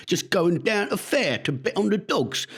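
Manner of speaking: mangled mock Cockney, pitched high; Al Pacino. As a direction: do a terribly faked East London Cockney accent. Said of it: "bets on the dogs in East London".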